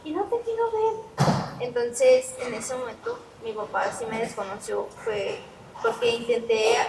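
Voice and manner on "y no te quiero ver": high, loud hysterical refusal; imitated high-pitched screaming fit; retold hysterical yell. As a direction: act out yelling hysterically at a parent. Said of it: "bien histérica, o sea, empecé a gritar".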